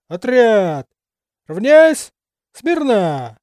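stiff barking toy soldier commands; short bark. A narrator's reading. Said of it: "коротко рявкнул".

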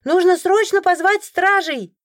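high, drawn-out call over a crowd; high, slow-stretched alarmed shout; urgent cry. A narrator's reading. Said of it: "вдруг кто-то выкрикнул".

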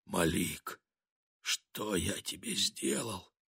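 very soft, bewildered whisper. A narrator's reading. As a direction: barely breathe the question. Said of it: "прошептал".

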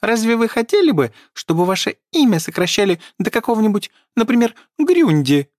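high, indignant elf lecture; indignant squeaky elf. A narrator's reading.

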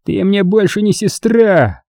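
slow, high, bitter renunciation; drawn-out angry outburst without much volume; angry cry. A narrator's reading. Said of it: "Хорд крикнул ей".

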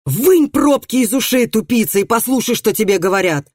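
loud, high-pitched scolding; angry threat. A narrator's reading. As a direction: lash out at a fool who won't listen. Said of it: "не испугался угроз пристава".

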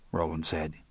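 usual speaking voice

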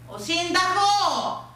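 high, drawn-out call to the mayor. Acted. Priest spots the mayor passing, calling out. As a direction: hail him, stretching the word out.

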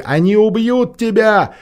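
desperate cry. A narrator's reading. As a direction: cry out in despair. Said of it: "слился с криком Лемешевой".

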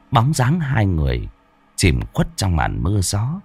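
plain reading voice

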